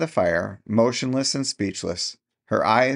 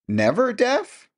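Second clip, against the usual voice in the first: much higher, slow disbelieving cry; incredulous; slower than usual. A narrator's reading. Mary to her aunt in bewildered disbelief, high incredulous. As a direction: rise in shock on deaf.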